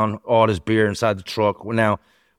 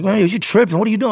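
high-pitched incredulous complaint; imitating an acquaintance scolding his would-be robber; indignant protest; 11 semitones higher than usual. Victim complains after being robbed.

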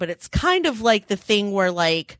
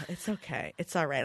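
much softer, nearly breathed reassurance; hushed reassurance; 4 semitones lower, 13 dB quieter than usual. Retold or imitated. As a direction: murmur it softly, almost to yourself. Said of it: "I'm like".

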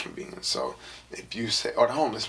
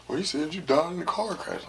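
teasing friend voice; 7 semitones higher than usual. Retold or imitated. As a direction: call out the dad like a nosy schoolkid. Friend tells dad the lie.

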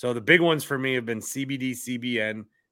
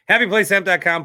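high, loud ad pitch; sponsor plug; 7 semitones higher, 7 dB louder, faster than usual. Real, not acted. Host giving listeners the sponsor's website, punchy ad pitch. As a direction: punch out the web address like an ad. Plugging his sponsor promo code.

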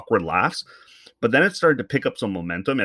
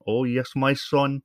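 slow, higher mock Newfoundland accent; a light East Coast Newfoundland drawl; Newfie accent; 4 semitones higher, slower than usual. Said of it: "with their East Coast accent".

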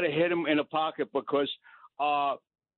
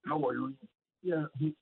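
off mic; 3 semitones lower, 5 dB quieter than usual. Real, not acted. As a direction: voice far from the microphone. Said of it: "[name], you're off mic".

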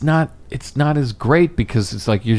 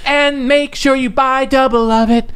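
parade announcer; 11 semitones higher than usual. Retold or imitated. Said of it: "'Cause they're like".

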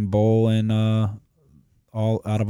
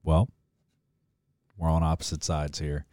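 ordinary talk

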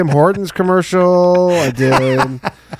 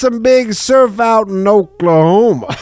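slow, louder Southern twang, pitched up; mock his Southern take on a surfer; southern drawl; 6 semitones higher, 4 dB louder, slower than usual. Teasing friend's Oklahoma surfer in a slow mild drawl. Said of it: "You go".

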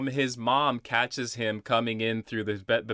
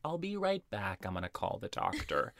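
softer, hushed mock-mom voice; mother's soft aside; 9 dB quieter than usual. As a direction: say it softly as the departing mother. Acts mother stepping away.

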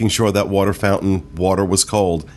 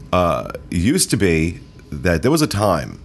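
ordinary talk

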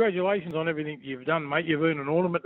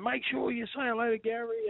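quoting wife; 6 semitones higher, 7 dB quieter than usual. Retold or imitated. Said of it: "she's saying".

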